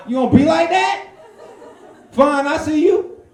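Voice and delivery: high, loud hammed-up catcall; catcalling dude. Comic acting out a guy catcalling a girl, high and loud. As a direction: holler at the girl, persistent and pushy.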